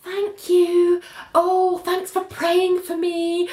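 fake sweet thanks. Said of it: "she's like".

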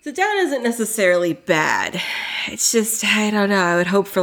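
usual speaking voice

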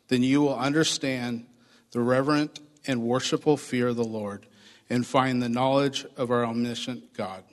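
ordinary talk, nothing marked

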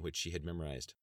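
ordinary delivery

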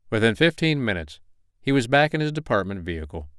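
usual speaking voice

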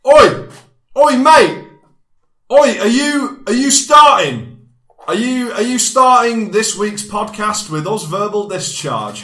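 loud, high "Oi, mate" shout; shouting at listeners like a lairy lad; aggressive bloke. Acted. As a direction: square up like a pub thug picking a fight.

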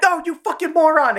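very high, exasperated imitation; mock alarmed outburst. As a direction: yelp it in exaggerated alarm. Retold panic at gun about to blow.

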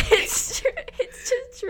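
high, squeaky insistence through laughter; helpless laughing while insisting; laughing protest. Real, not acted. Defending the baked beans claim, laughing hard.

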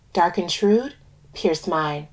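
ordinary delivery, nothing marked

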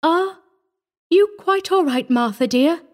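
high, hesitant, tender asking; shaken, tentative question. A narrator's reading. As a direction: ask the child haltingly after a fright. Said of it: "she whispered after a moment".

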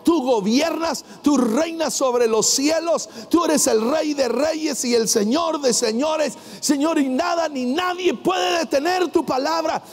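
high, exalting proclamation to God; praying out loud. Acted. Preacher acts Paul and Silas exalting God, out loud. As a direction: build the praise phrase by phrase. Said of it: "es en voz alta, adorando, exaltando".

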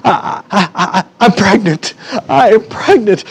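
acted panting, stammering announcement; out of breath. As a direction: gasp it out after jumping up and down. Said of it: "She's all out of breath".

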